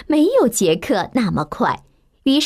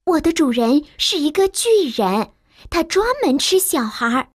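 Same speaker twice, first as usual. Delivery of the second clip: higher, urgent maid warning; worried servant; 6 semitones higher than usual. A narrator's reading. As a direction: warn the boy about the giant. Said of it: "佣人警告说". (着急的女佣警告)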